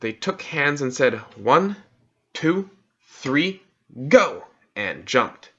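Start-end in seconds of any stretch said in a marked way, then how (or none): 1.4-4.4 louder, higher count building to go; two children count together before jumping in. A narrator's reading. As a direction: call out the count then leap.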